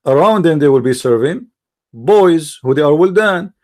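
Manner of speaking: ordinary talk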